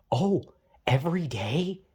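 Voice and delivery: whispered mock question; sarcastic whisper. Sneering at relatives, a mock whispered question. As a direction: hiss the question in disbelief.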